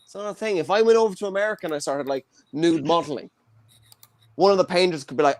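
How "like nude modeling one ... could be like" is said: normal talking voice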